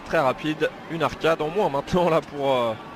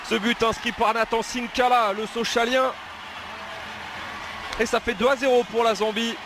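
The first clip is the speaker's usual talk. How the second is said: excited sports commentary; 7 semitones higher than usual. Real, not acted. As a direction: shout the goal, lifted high. Second goal call.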